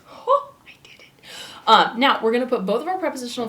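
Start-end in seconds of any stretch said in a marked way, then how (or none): 0.1-1.0 high, thrilled whisper; a tiny private victory; excited whisper. Real, not acted. Teacher proud of writing sideways, thrilled whisper.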